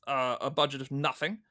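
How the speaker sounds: normal talking voice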